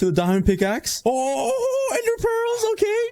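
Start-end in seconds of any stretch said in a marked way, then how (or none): 1.0-3.1 very high squeal of delight; thrilled. Real, not acted. Gamer finding ender pearls, squeal of delight. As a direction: squeal over the loot.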